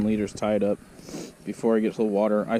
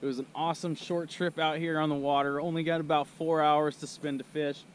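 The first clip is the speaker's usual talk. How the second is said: higher, upbeat ad read; sponsor outro; 6 semitones higher than usual. Acted. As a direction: wrap up the vlog cheerfully.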